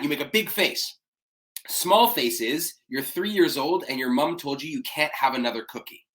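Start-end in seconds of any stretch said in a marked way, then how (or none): none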